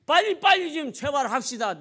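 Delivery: urgent pleading. Acted. Waiting father begging the doctor to hurry, urgent pleading. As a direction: press the doctor to hurry, voice jumping up. Father's plea escalates on repeat.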